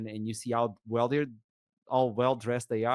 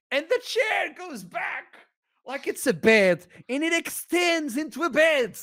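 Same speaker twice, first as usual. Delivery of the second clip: high, thrilled retelling; amazed; 12 semitones higher, 4 dB louder than usual. Retold or imitated. Telling friends about a seat turning into a bed, amazed. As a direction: gush in wonder at the luxury seat.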